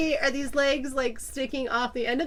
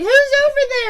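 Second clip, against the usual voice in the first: put-on high, loud drunken call; 13 semitones higher, 10 dB louder than usual. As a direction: call out like a tipsy friend. Drunk friend calling out.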